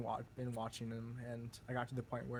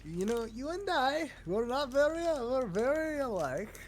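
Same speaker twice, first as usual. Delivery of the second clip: mock proud; 9 semitones higher, 6 dB louder than usual. Joking mock praise.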